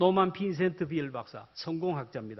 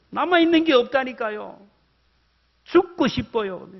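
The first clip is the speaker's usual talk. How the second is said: despairing protest; 5 semitones higher, 6 dB louder than usual. Retold or imitated. Despairing man insists to counselor.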